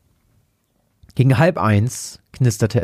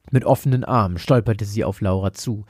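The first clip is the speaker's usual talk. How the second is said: usual speaking voice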